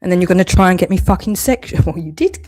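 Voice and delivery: ordinary delivery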